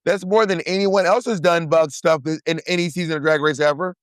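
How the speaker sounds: high playful banter; excited banter. Real, not acted. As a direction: pitch way up in playful disbelief. Teasing banter about bug acts.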